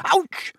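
sharp high ouch; boy yelping as he is trodden on; yelp of pain. Acted. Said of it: "said Neville".

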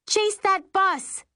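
high, slow, loud yell; screaming. Acted. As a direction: yell it after the bus. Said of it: "I scream".

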